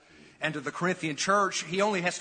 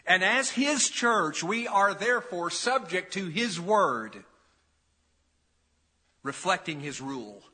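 forceful preaching; 2 semitones higher than usual. Acted. Preacher declaring to the church that Jesus is Lord, forceful. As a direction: lean hard on each phrase.

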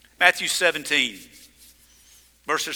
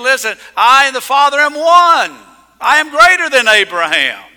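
loud, firm declaration as Jesus; Jesus proclaiming; 5 semitones higher, 10 dB louder than usual. Acted. Preacher voicing Jesus to the Pharisees, loud and firm. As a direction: proclaim it boldly, voice raised.

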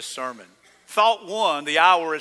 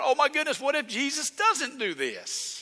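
higher, softer mock fretting; mock worry; 7 semitones higher, 6 dB quieter than usual. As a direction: play a hammed-up worrier, lightly. Mocks a worried God in heaven.

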